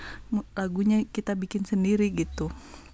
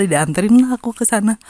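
ordinary talk

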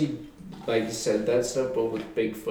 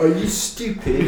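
loud, laughing British jeer; mock British accent; 5 semitones higher, 4 dB louder than usual. Friend trading mock insults, in a loud British accent. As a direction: sneer it like a rowdy Brit.

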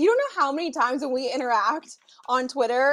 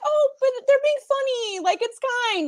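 high, lilting playful reassurance; breezy self-quote; 12 semitones higher than usual. Retold or imitated. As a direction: wave it off in a high, light voice. Retells her breezy answer to commenters.

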